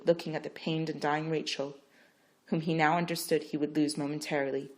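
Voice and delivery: usual speaking voice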